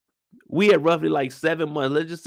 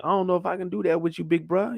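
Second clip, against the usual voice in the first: higher and quick, unsure little brother; higher, faster hesitant younger brother; Tommy's hesitant voice; 7 semitones higher than usual. Acted. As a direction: rush it out, backing off.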